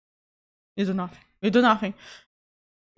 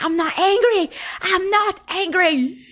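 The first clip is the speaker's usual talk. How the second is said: high, drawn-out mock protest; 8 semitones higher than usual. Streamer answering comments about her angry look, mock protest. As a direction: insist it with exaggerated indignation.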